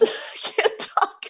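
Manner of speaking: squeaky breathless laugh through the words; too amused to get it out. Real, not acted. Laughing at her own story while telling it.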